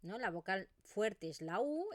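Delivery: normal talking voice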